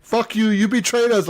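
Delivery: high, hammed-up indignation; mock accusation. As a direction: act out trash talk at a traitor.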